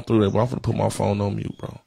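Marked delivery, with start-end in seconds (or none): none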